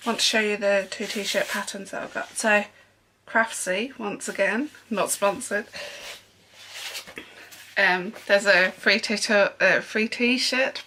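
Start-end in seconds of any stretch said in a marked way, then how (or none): none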